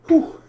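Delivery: sharp high exhale of relief; high breathy whew. Real, not acted. Recovering from smoke.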